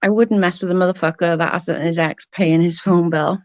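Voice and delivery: ordinary talk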